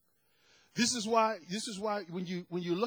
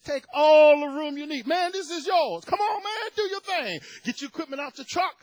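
loud, high, welcoming call to a worker; hearty come-on-in voice; eager reassurance; 6 semitones higher, 9 dB louder than usual. Retold or imitated. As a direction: wave a shy man in generously. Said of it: "I said".

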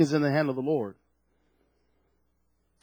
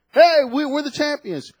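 mock champions cheer; 8 semitones higher than usual.

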